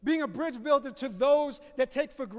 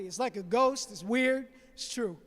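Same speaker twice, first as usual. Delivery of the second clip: usual speaking voice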